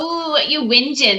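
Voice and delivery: teasing Aussie imitation; mock Australian accent. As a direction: parrot it back with an Australian twang. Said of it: "That's very Aussie".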